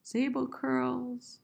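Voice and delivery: high, slow "sable curls"; very slow, lifted recital of SABLE CURLS; high pitch. Shown as a demonstration. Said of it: "use high pitch too much".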